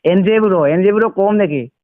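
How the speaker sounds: ordinary delivery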